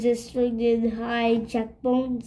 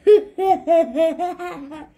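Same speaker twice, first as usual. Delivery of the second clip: a louder, higher cackle of laughter; 4 semitones higher, 4 dB louder than usual. Acted. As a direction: howl at your own joke. Petey laughing.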